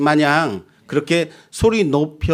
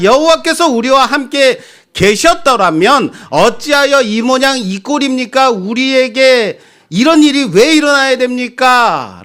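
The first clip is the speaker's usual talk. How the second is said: indignant complaint; 8 semitones higher, 3 dB louder than usual. Acted. Preacher voicing Gideon's complaint.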